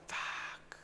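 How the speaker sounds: under-the-breath swear; whispered curse. Real, not acted. Trader watching the price dump, whispered curse. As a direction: hiss it to yourself.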